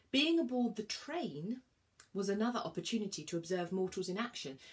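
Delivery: plain reading voice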